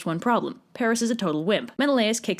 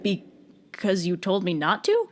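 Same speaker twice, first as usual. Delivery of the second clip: slow puzzled reply; 2 semitones lower, much slower than usual. Acted. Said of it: "And he's like".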